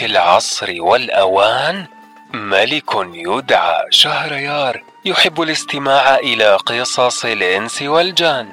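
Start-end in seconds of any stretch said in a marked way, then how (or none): none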